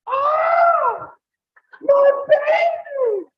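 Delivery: panicked mother shriek. Retold or imitated. Husband imitating his wife screaming over their hurt son, very high shriek. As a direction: shriek in hammed-up panic for the baby. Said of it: "all of a sudden I hear it".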